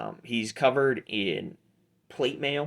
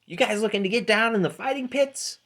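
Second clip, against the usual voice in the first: higher-pitched halfling hustler; Pete; 12 semitones higher than usual. Acted. Halfling tout sizing up new fighters, hustler's pitch. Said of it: "And Pete says".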